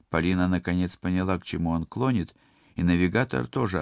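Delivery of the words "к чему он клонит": ordinary delivery, nothing marked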